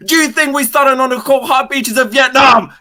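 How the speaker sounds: shouted high and loud; a sergeant roaring a war-veteran rebuke; drill sergeant yell. Acted. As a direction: bellow it at the recruit. Drill sergeant yelling at recruit.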